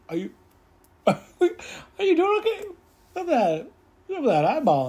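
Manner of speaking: high, loud, fussing concern with a laugh; mock-anxious woman checking on him; imitating a worried woman. She fusses over his eyeball, high and anxious. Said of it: "She's like".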